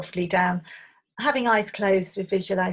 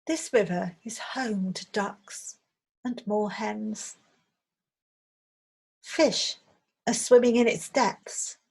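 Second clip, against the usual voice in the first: guided meditation; 3 dB louder than usual. Acted. Meditation teacher leading listeners on an imagined riverside walk, calm guided visualization. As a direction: guide listeners gently, phrase by phrase.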